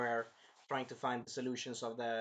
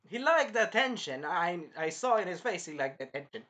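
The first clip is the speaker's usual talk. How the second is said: normal talking voice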